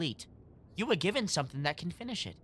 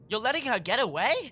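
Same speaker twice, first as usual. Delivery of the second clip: incredulous; 7 semitones higher, 6 dB louder than usual. Acted. Disbelief at letting her go.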